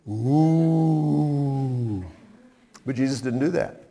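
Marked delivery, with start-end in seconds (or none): 0.1-2.0 soft, drawn-out mock ghost oo; a playful, quiet teasing ghost noise; spooky ghost moan. Jesus teasing frightened disciples, as a softer playful ghost moan. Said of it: "I might have been tempted to go".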